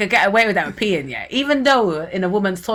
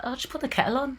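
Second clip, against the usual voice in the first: breezy dismissal; 6 semitones higher, 9 dB quieter, much faster than usual. Retold or imitated. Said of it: "everyone's just a bit like".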